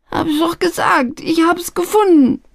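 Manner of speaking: very high, pitiful whine; high-voiced lad pleading innocence; wailing protest. A narrator's reading. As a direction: whimper it in exasperated despair. Same young man wailing, questioned again.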